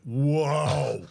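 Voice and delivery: loud, drawn-out whoa; mock-impressed whoa. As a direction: re-enact instantly reacting to an insult. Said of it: "instantly I went".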